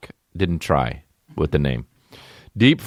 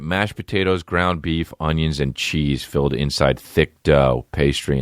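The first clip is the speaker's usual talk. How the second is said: ordinary delivery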